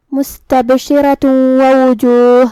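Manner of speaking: مستبشرۃ ووجوہ joined, nasal ghunna merged into the و; slow chanted ووجوہ with a held nasal merge; idgham with ghunna. Shown as a demonstration. Teacher reciting مستبشرۃ ووجوہ to show idgham with ghunna. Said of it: "غنہ اور تشدید کے ساتھ ادا کریں گے".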